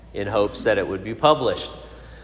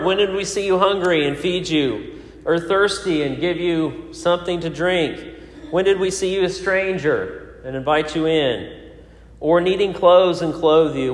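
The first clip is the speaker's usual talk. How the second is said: lifted, higher reading of the righteous asking the Lord; scripture reading; 5 semitones higher than usual. Acted. Pastor reads Matthew twenty-five to the congregation, higher and fervent. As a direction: declaim the verses with rising fervour.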